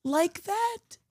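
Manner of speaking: high, slow coaxing imitation; mock sweetness. Wife imitating husband offering dinner, mock sweetness.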